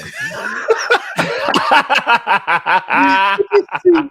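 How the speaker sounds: high, loud, sustained real laughter; uproarious laugh. Real, not acted. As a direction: burst out laughing uncontrollably. Cracking up at viewer's comment.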